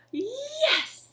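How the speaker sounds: high, delighted yelp of success; excited cheer. Real, not acted. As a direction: celebrate like the trick finally worked. Trick finally works.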